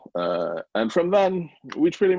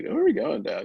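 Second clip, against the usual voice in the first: put-on much higher-pitched kid's question; retold child's voice, pitched way up; imitating young son; 3 dB louder than usual. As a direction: lightly act out a son asking Dad. Retelling son's question to dad.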